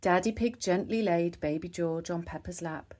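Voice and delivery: ordinary delivery, nothing marked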